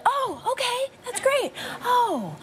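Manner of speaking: high, strained fake delight; forced cheerful. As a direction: hide the panic behind cheer. Retold fake-cheerful reaction.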